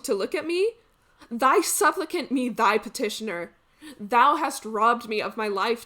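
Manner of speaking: loud, declamatory Greek tragedy, accusing then begging; tragic hero pleading. Acted. Robbed hero begging a rogue for his bow, impassioned. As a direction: plead with heavy, ringing stage emphasis. Performs Sophocles tragic monologue.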